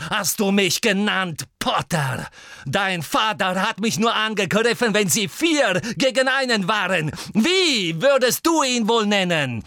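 loud, high mocking taunt; jeering. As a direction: throw the words back with scorn. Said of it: "höhnte Snape". (höhnisch und laut spotten)

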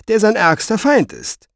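pointed explanation; emphatic statement. Acted. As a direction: state it as grim fact. He explains the danger.

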